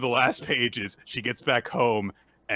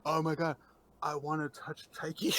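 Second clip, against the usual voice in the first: lightly raised innocent confession; 4 semitones higher, 4 dB quieter than usual. Retold or imitated. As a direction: say it softly, like an innocent admission. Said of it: "she says it really innocently".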